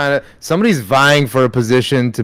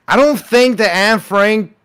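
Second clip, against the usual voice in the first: lightly voiced Southern conspiracy guy; southern accent; 7 semitones higher, 7 dB louder than usual. Joke teller doing the bit in a light Southern accent. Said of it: "saying these in a southern accent".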